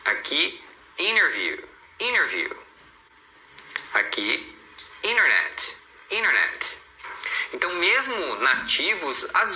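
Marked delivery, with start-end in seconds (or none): 1.0-2.5 interview as "innerview"; dropped T. Shown as a demonstration. Teacher giving learners examples, interview without T. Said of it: "eles omitem esse T". 5.0-6.8 internet as "innernet"; dropped T. Shown as a demonstration. Teacher giving learners examples, internet without first T. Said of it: "eles omitem esse T".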